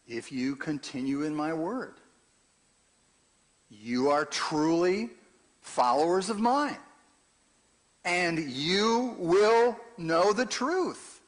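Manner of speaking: loud, slow sermon reading; emphatic scripture reading. Acted. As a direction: lean hard into every word. Reads Jesus's words with force.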